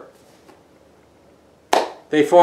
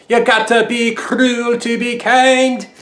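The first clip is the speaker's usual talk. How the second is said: slow, higher, sung in a thick Scottish brogue; Scottish accent sung slowly and high; Groundskeeper Willie voice; 8 semitones higher, 3 dB louder, slower than usual. Retold or imitated. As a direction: croon it like the Scottish groundskeeper teasing his wife. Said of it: "Groundskeeper Willie voice".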